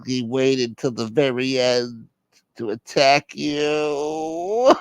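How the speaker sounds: slow, dramatic put-on parent voice; aggrieved brooding caller. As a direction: stew over the insult, stretching the endings. Plays aggrieved caller in skit.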